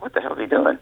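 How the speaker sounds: whispered, baffled aside; stunned whisper. Retold or imitated. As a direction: breathe it out in disbelief. Watching caddy jump for needles.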